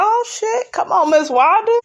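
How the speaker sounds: high-pitched playful "oh shit" reaction; mock startled reaction. Viewer reacting to Miss Wanda's boast, mock startled. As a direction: egg her on in a high, amused voice.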